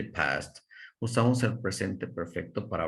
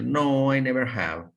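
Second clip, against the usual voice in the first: emphatic model answer; 6 semitones higher, 5 dB louder than usual. Shown as a demonstration. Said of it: "Vean, podemos decir".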